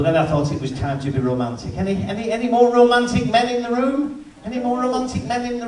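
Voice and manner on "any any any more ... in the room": louder call out to the crowd; comic calling loudly to the audience. Real, not acted. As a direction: throw the question out to the room.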